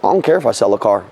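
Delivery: mock the lazy salesperson; apathetic mock. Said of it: "Nowadays, they're just like".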